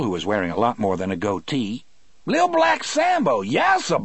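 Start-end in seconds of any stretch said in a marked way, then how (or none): 2.3-3.4 very high, loud taunting voice; mocking caricature voice. Inmate mocks guard, laughing. 3.4-4.0 very high, loud taunting yassa; mocking caricature voice. Inmate mocks guard.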